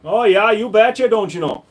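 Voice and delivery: put-on playful Minnesota accent for the chat. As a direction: lay on the Minnesota nice accent. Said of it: "Minnesota, eh?".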